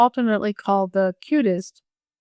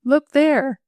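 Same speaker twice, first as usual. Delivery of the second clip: higher, urgent command; crying out; 6 semitones higher, 4 dB louder than usual. A narrator's reading. Boy shows the girl the open water, crying out. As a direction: cry it out as a warning. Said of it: "cried Will".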